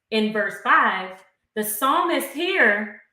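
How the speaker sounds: ordinary talk, nothing marked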